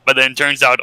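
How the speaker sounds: normal talking voice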